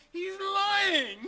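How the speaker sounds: high, loud laughing yell; shouting through laughter. Acted. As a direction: crack up while shouting the punchline. Owner laughing shouts the punchline.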